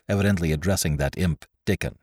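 plain reading voice

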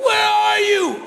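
high, drawn-out mock shout; guy calling out. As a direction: call across open water in hammed-up desperation. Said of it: "you hear the guy go".

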